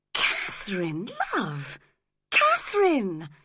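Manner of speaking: shocked. Acted. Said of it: "cried Edgar, shocked".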